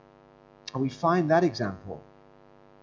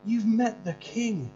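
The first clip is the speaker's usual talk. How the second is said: joyful pastor; 9 semitones higher, 7 dB louder than usual. Retold or imitated. Pastor rejoicing with student over meeting Jesus. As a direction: celebrate her encounter with rising delight. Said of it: "I said".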